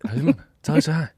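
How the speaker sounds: joking Boston-accent Mark Wahlberg impression. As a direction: toss off a casual Boston greeting like Mark Wahlberg. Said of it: "Are you doing Mark Wahlberg?".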